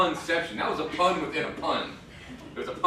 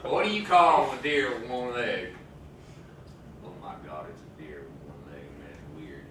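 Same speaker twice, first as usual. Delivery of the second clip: Southern face voice; 7 semitones lower than usual. Pretend. A comedian shows friends his Southern face accent, speaking much lower. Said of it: "show you my Southern face".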